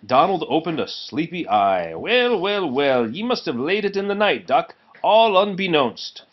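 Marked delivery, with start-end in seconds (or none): 1.9-6.2 higher, sly Scots tease; a touch of a drowsy Scottish engine; Scottish accent. Acted. Donald voiced in Scots.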